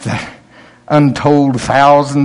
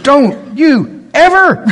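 high, loud, slow scolding yell; scolding shout; 12 semitones higher, 5 dB louder, much slower than usual. Retold or imitated. Grandfather scolding granddaughter at the curb, loud and high. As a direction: bark it at a runaway toddler.

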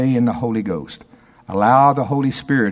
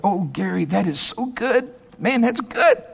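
mock indulgent praise; 10 semitones higher than usual. Imitating Dave's patronizing praise.

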